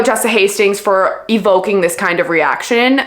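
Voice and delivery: ordinary talk, nothing marked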